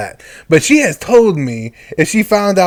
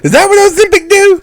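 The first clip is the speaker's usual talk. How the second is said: much higher, louder squeaky question; 4 dB louder than usual. Pretend. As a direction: pitch way up in mock disbelief. Joking question about weight loss.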